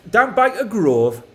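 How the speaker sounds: put-on a light, amateur Geordie accent; a son asks his dad for a Geordie accent, mock Geordie lad. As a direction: put on a hammed-up Geordie lad's voice. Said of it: "True Geordie currently live streaming".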